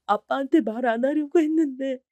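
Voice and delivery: high, tearful confession; weeping while telling dad; crying. Acted. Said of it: "엉엉 울면서 말을 해버렸답니다". (딸이 아빠에게 울며 털어놓음)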